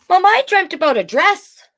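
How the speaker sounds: put-on child telling mother about a dream. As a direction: sound like a hopeful young child. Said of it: "said Bailey".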